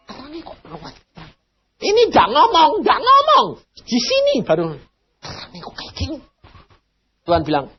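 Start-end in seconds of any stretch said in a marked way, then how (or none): 0.1-1.3 hushed awe. Retold or imitated. Preacher retells asking God in awe. 5.2-6.2 breathless whisper, wondering; awed whisper. Retold or imitated. Preacher marvels at heaven's light, whispering.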